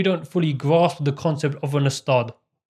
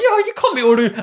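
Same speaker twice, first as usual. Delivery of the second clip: much higher and louder, squeezed out through laughter; laughing protest; 6 dB louder, much faster than usual. Real, not acted. Telling his brother off, laughing high and loud. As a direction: protest while cracking up. Quoting himself while laughing.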